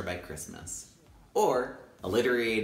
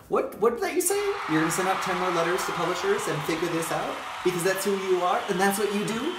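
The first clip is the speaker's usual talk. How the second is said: exaggerated mock disbelief; 8 semitones higher, 4 dB louder than usual. Playful mishearing game.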